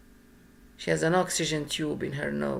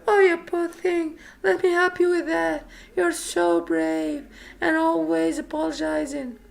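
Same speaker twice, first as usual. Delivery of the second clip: much higher, sugary pitying coo; mock pity voice; 11 semitones higher than usual. [name] mocking the people who pitied her, in a much higher fake-sympathy coo. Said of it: "People were so nice, it was disgusting".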